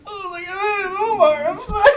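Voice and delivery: high-pitched mock sobbing apology; squeaky crying through an apology; imitated crying. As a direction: re-enact a tearful apology, voice way up high. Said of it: "I literally started crying".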